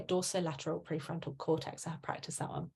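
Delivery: murmured aside, whispered by the end; quiet aside. Real, not acted. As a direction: drop your voice to confide, fading almost to nothing. Jokey aside after a hard term.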